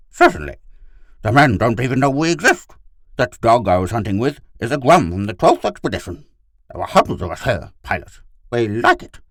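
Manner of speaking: high, loud dog character; enthusiastic talking dog. Acted. Gur tells Pid of the settlers, eager dog.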